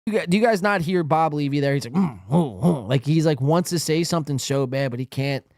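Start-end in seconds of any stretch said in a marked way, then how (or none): 1.9-2.9 mock stifled groans; imitated moaning. Host mimicking Bob Levy biting his tongue, imitated moaning and groaning. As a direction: groan with closed lips like you're holding back words. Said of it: "Bob Levy moaning and groaning over here".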